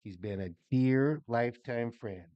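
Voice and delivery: poem reading. Acted. Reads birthday poem aloud.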